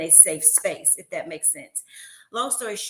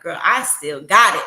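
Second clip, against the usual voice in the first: sassy boast; 3 semitones lower, 10 dB louder than usual. Retold or imitated. Boasting retort to former student.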